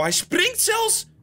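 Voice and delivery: high, slow incredulous squeal; disbelief. Real, not acted. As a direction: squeak it out in shock. Hunter jumps in water. (ongelovig piepen)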